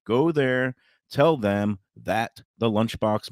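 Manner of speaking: normal talking voice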